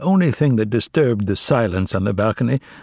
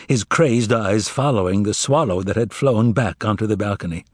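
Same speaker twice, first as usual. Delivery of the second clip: usual speaking voice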